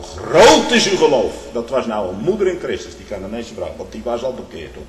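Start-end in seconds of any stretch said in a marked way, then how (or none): 0.0-1.3 louder, higher, slow "groot is uw geloof"; solemn proclamation. Acted. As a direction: proclaim it slowly with awe. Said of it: "Christ praising the woman".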